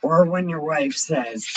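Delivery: ordinary delivery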